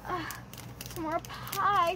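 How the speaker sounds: normal talking voice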